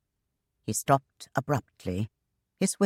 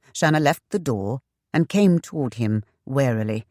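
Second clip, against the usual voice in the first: ordinary delivery, nothing marked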